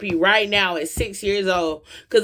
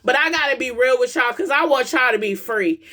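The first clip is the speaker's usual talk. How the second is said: raised, urgent preaching voice; 5 semitones higher than usual. Acted. Preacher urging parents to hear their kids, raised and urgent. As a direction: preach it higher to wake the crowd up. Preaching to the crowd about healing.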